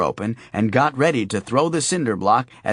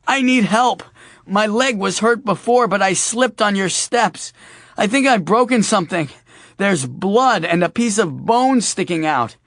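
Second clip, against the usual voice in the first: pleading in pain; 8 semitones higher, 5 dB louder than usual. Acted. Faking injury to old lady.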